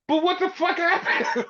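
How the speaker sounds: high, laughing incredulous question; baffled. Retold or imitated. Punk asking Jay what happened, high laughing disbelief. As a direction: ask it way up high, cracking with laughter. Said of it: "he's so fucking baffled".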